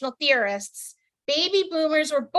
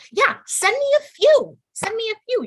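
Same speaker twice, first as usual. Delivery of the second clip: mock eager; 11 semitones higher, 5 dB louder than usual. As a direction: squeal it like you can't wait. Mock eager reply to dick pic.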